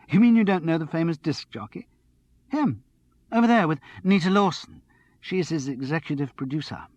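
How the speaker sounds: a hint of a breathless, excited girl; excited tipsy young woman.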